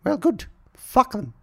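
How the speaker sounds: high, posh-put-on old queen; a falsetto queen impression, lightly hammed; mock Queen voice. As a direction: play the Queen, high and prim. Queen voice retorts.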